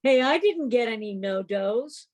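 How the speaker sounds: usual speaking voice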